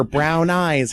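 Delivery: usual speaking voice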